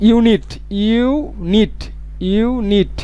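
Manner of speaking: ইউনিট drawn out slowly, the ইউ sound stretched; sounding out unit. Shown as a demonstration. Teacher to students, drawing out ইউনিট slowly. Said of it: "ইউটা হচ্ছে গিয়ে vowel".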